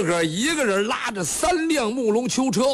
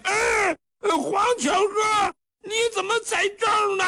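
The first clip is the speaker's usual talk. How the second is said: much higher, shouted joyful greeting; overjoyed; 12 semitones higher than usual. A narrator's reading. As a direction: spot an old friend below and yell down happily. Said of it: "当时高兴了".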